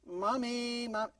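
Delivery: imitated drawn-out whine; whining child. Child coming right back at mom, whining. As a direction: start whining at a parent. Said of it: "If they come back immediately with".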